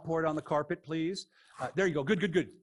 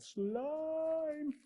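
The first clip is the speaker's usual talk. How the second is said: much higher, very slow sing-song announcement; funny drawn-out voice; 13 semitones higher, 4 dB quieter than usual. Pretend. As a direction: stretch it out like a goofy game-show reveal. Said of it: "There it goes again, those words".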